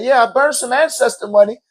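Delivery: a lightly voiced cousin, proud; imitating her. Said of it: "She said".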